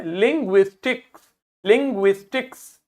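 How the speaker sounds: लिंग्विस्टिक्स in clearly separated syllables; pronunciation demo. Shown as a demonstration. Teacher asks pupils to read it, syllable-by-syllable लिंग्विस्टिक्स. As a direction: sound out लिंग्विस्टिक्स with a rising end. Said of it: "इसको पढ़िए".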